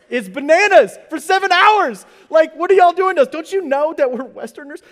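mock outrage. Preacher telling the congregation about the dead screens, in loud mock outrage. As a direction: rant like a wronged Westerner.

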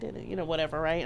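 soft, low mock whisper calling a name; barely voiced call across the bed. Calling her husband in a weak hospital whisper. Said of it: "I'm whispering basically".

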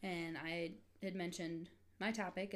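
usual speaking voice

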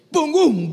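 loud, high "pum, pum" crash; booming imitation of a body hitting the floor; imitated thud. Preacher imitating a man falling at the prophecy, loud thuds.

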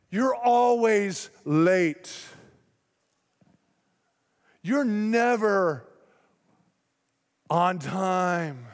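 slow, drawn-out mock accusation; hammed-up scolding, very slow; accusing. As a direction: lay the blame heavily, word by word. Acted accusation in a quarrel.

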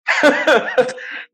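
a quick high burst of laughter; short laugh. Real, not acted. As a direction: laugh it off, amused at yourself. Risada após a prece. (risada curta e aguda)

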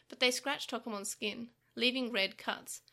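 usual speaking voice

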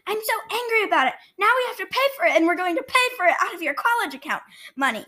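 high, fast mock-angry parent rant; sneering panto villain. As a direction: ham up an angry mom. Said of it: "You were like".

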